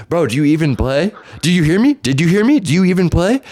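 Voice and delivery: high, rising, challenging questions; insistent taunting. Retold or imitated. Imitating the opponent repeating his chess taunt, insistent. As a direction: needle him, each question pushed harder.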